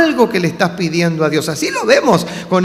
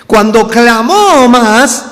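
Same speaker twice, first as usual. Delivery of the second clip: loud, slow, raised declaration; emphatic preaching; 4 semitones higher, 5 dB louder, slower than usual. Real, not acted. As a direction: proclaim it with drawn-out force. Preacher builds on the clamour. (predicación enfática, fuerte y pausada)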